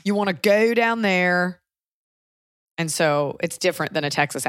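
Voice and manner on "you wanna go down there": slow Carolina-accent demo; drawling Carolina accent. Shown as a demonstration. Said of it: "They have that here".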